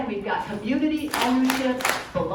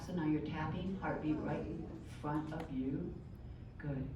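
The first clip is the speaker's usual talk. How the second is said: soft, low-volume guiding voice; gentle guided listening; 3 semitones lower, 11 dB quieter than usual. Shown as a demonstration. Teacher guides the group to find their heartbeat, soft guidance. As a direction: quietly draw the group inward.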